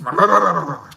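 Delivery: satisfied food moan; stuffed contented moan. Retold or imitated. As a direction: groan happily like you've eaten too much. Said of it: "full stomach moan".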